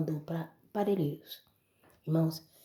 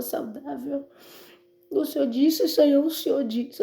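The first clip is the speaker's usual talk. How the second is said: much higher and louder, pleading; desperate cry to God; 9 semitones higher, 8 dB louder than usual. Retold or imitated. Mother in hospital crying out to God, loud anguished plea.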